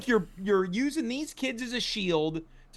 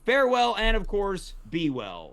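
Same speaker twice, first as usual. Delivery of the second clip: grand farewell; 3 semitones higher than usual. Acted. Grand sign-off.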